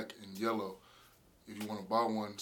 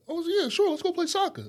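much higher, louder, sing-song mock agreement; mock naive foreigner; 9 dB louder than usual. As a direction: play the clueless newcomer cheerfully agreeing. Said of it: "You're like".

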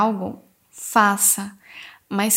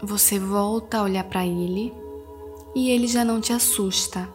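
soothing spa whisper; 3 dB quieter than usual. Acted. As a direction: guide them gently into calm. Leads a guided meditation. (voz suave de meditação guiada)

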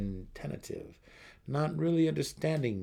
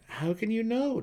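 put-on high, louder young voice asking; questioning son; 14 semitones higher, 5 dB louder than usual. A narrator's reading. Son Dinan blurts a question to his mother. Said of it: "Dinan questioned".

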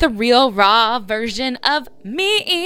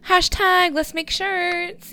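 playful fan greeting; 10 semitones higher, slower than usual. Pretend. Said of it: "Just be like".

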